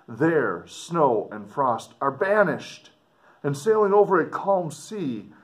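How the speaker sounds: dramatic reading. Acted. Teacher reading the explorer's letter aloud to students, expressive. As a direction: read it out to the class with feeling.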